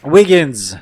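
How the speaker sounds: loud call of a name. Pretend. Player summoning young helper Wiggins, calling out loudly. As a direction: call it out sharply for someone to come. Calls out to a game character.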